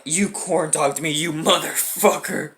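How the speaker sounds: low, slow and quiet, a hint of menace; menacing threat. Teen confronts the kid who kneed him, low slow menacing threat. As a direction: lean in and growl it slowly. Confronting each attacker for revenge.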